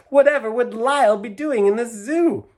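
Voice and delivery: high, loud anguished question; distressed owner protesting, high and loud; miserable protest. A narrator's reading. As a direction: plead against the injustice. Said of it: "exclaimed miserably".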